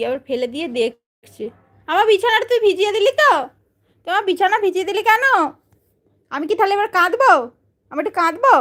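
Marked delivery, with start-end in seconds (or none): 1.9-8.5 high, laughing fake complaint about the wet bed; mock hurt pet talk. Real, not acted. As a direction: pretend to be wounded, pitched up and half laughing. (উঁচু গলায় হাসি মেশানো নকল অভিমান)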